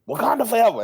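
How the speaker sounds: high, loud rallying call; coworker's Wakanda salute. Retold or imitated. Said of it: "he was like".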